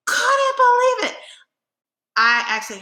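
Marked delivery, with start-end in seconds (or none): 0.1-1.2 very high, loud squeak; utter disbelief. Real, not acted. Amazed she enjoyed shooting that gun, squealed disbelief. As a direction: push it right up into an incredulous squeal. Repeats her astonishment, higher.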